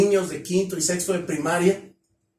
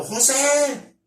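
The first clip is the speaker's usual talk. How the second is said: high, loud call of a name; 7 semitones higher, 3 dB louder than usual. Shown as a demonstration. Speaker shows how to call out a name to draw attention. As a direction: shout a name down the street. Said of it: "tú te agarras gritando".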